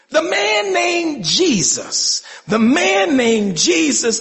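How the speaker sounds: drawn out, high on the name of Jesus; awed testimony; slow reverent naming. Acted. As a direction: savor the words, slowly. Healed man names Jesus.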